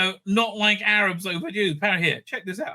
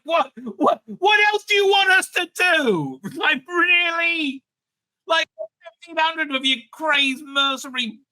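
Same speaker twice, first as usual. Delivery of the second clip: raised, exasperated rant; exasperated shout; 7 semitones higher than usual. Real, not acted. Arguing about the Israel–Gaza war, exasperated shout.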